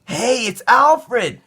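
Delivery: put-on high, slow, happy little boy; child Caillou voice. Caillou spotting Alfred, high boy voice. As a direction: greet the dog like a delighted small boy. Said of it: "Caillou said".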